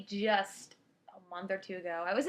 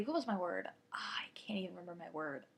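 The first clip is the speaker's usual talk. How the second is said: whispered aside; 5 semitones lower, 11 dB quieter than usual. Real, not acted. Trying to recall a word aside.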